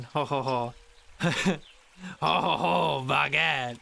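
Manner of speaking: hammed-up French laugh and baguette; over-the-top French caricature; mock French accent. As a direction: play the cartoon Frenchman for laughs. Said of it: "Let's hear the accent. Come on".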